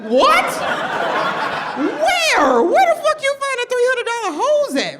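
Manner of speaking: high, loud disbelieving squeal; shocked outburst. Retold or imitated. Comic telling the class her shock at a three hundred dollar weave. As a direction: shriek it in outraged disbelief.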